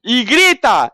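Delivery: usual speaking voice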